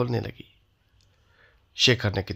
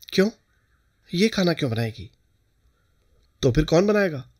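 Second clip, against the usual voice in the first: surprised; 5 semitones higher, faster than usual. A narrator's reading. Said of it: "Shekhar के स्वर में आश्चर्य था".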